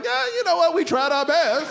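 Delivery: high, carefree mechanic voice; breezy mechanic. Retold or imitated. Mechanic to the customer about the brakes, breezy and high. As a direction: shrug it off cheerfully. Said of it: "the dude's like".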